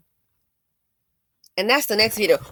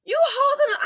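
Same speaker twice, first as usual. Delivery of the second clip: exasperated outburst. Retold or imitated. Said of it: "I'm like".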